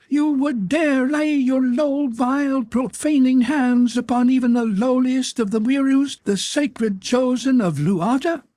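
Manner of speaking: high-pitched shriek of rage and hatred; enraged scream. A narrator's reading. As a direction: scream it in outrage, drawing out the words. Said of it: "he screamed".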